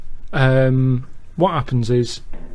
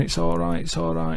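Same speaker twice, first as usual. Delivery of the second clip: hushed, breathy reassurance; soothing hush; 3 semitones higher, 6 dB quieter than usual. Acted. Girlfriend hushing the injured man at his bedside, soft reassurance. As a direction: whisper it gently to calm him.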